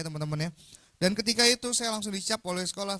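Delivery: ordinary talk, nothing marked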